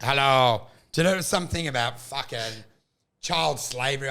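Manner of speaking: lightly imitated AI greeting. Comic demonstrating his stage bit, mock AI voice. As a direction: say hello like a computer voice. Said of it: "it was the A I voice going like".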